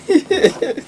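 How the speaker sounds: squealing high giggle of joy; a giddy burst of high laughter. Real, not acted. Thrilled vlogger giggling at her launch.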